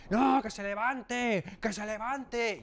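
más agudo y lento, voces insistentes; tumulto gritando. Retold or imitated. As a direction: imita a la clase presionando. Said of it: "Hubo un tumulto allí". (la clase exigiendo a gritos que se levante)

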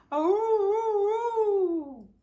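loud high drawn-out howl; dog howl. Acted. Dog George howls loud for his stuck friend. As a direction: throw the head back and howl. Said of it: "George is great at howling".

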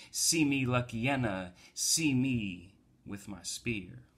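performed poetry reading; poem recital. Acted. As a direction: proclaim each line like a spoken-word poet, falling at the ends.

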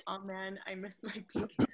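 ordinary talk, nothing marked

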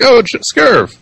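lightly voiced, high and breezy; imitating an offhand reminder; mock casual reminder. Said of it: "Or you're like".

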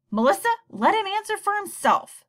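a father cutting off his wife so their son answers; loud command. Acted. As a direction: cut her off hard. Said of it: "Dad said loudly".

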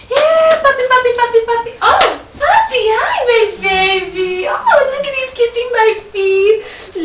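put-on high, loud baby talk to a pet; cooing at a puppy. Woman cooing at her man-puppy, high and gushing.

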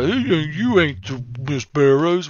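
slow, put-on dog voice, lightly hammed; voicing the dog. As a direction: drawl it out like a grumpy guard dog. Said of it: "because he's like".

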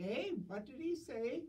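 put-on high, confused old lady asking; deaf old lady. Deaf old lady asking what the priest said, high and puzzled.

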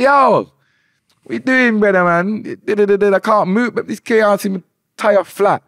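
high, loud indignant protest at the garage man; yelling at him not to ticket the car; retold shout. Man protesting a parking ticket over his flat tire, loud and high.

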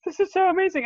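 high, loud burst of awe; starstruck excitement. Retold or imitated. Fan recalling seeing her hero on screen, starstruck. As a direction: gush like an overwhelmed fan.

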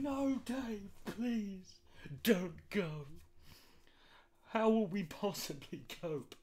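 mock pleading, slow on the last line. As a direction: plead with Dave not to leave. Voicing stand-up world begging.

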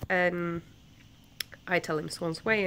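ordinary talk, nothing marked

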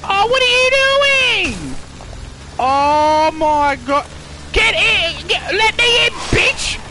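frantic squeal to be let in; high scared shouting; high panicked yelling. Real, not acted.

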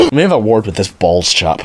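ordinary delivery

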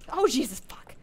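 sudden loud yelp of shock; startled. Real, not acted. Player startled by a character in the game, real loud shock. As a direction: jump and blurt it out loud.

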